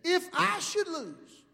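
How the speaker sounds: "If I should lose" high and drawn out; weighted quote. Retold or imitated. Preacher quoting Ali's fatal words, "If I should lose" slow and weighted. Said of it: "He said".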